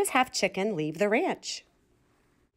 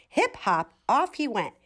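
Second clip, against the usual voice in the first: usual speaking voice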